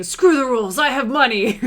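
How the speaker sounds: loud, high, smug villain boast; Kaiba. Retold or imitated. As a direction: shout it like an arrogant rich duelist. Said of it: "just Kaiba saying".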